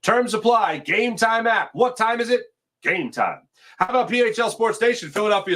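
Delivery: high, punchy announcer pitch for the app; hyped ad read. Acted. Host to stream viewers, pumped-up sponsor ad read. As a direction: sell it like a radio spot.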